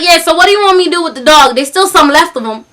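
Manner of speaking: quick mimicked country caller; thick country accent. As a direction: put on a casual country twang. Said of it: "talking in this thick country accent".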